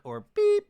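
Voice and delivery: high loud censor beep; sharp mock censor bleep; bleep imitation. Shown as a demonstration. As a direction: squeal it like a TV bleep. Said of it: "the beep".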